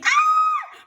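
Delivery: high terrified scream. Acted. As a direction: scream and run away. People screaming.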